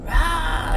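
sudden high mock yell of an angry boy; mimicked child yell. Son yelling at mom over the iPad, mock yell. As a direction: throw out a short high kid's yell, retold. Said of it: "he's yelling and stuff".

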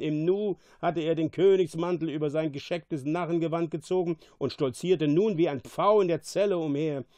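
ordinary delivery

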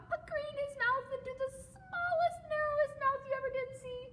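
very high narration through a small mouth; puckered tiny voice. A narrator's reading. Reader shows frog shrinking his mouth, high voice. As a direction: pucker the lips and squeak it.

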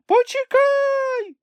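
long drawn-out high wail; squeaky yelling. A narrator's reading. Chmykh left behind calls to friends, squeaky yell. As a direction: holler in a tiny, piercing falsetto. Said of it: "горлав Чмих".

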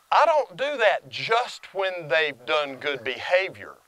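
ordinary delivery

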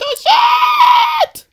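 very high, loud drawn-out scream; startled scream. Real, not acted. Player startled mid-game, startled scream. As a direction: scream the curse in sudden fright, stretched long.

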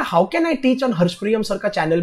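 ordinary talk, nothing marked

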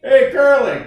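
normal talking voice

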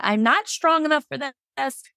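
high, desperate pleading without volume; imitated anguished cry, pitched high; retold desperate screaming. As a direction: re-enact begging through pain, pitch climbing. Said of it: "I was screaming at the ceiling".

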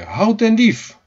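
high cry of "stop thief"; shouted alarm. A narrator's reading. Keetje shouting at the cat stealing her beef, alarmed shout. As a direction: shout after a thief, pitch leaping. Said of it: "schreeuwde Keetje".

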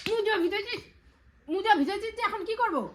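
real motherly telling-off, voice pitched right up; exasperated scolding. Real, not acted. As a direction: demand an answer, fed up. Mother scolds child over wet socks.

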